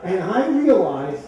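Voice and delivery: slow, high words through real laughter; laughing. Real, not acted. The speaker tells the audience he was set up, laughing. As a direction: laugh out the realization. Teller cracks up at punchline.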